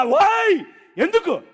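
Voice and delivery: high, loud anguished cry; high loud desperate protest; crying out to God. Retold or imitated. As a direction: shout your why up to heaven. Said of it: "ప్రభు వైపు చూస్తున్నా".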